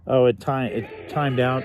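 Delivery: ordinary talk, nothing marked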